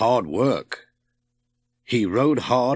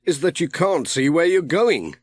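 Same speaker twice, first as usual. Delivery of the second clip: a hint of a grumbling postman, a little higher; Pat, lightly voiced; 6 semitones higher than usual. A narrator's reading. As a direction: Pat complaining mildly as he rows. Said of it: "said Pat".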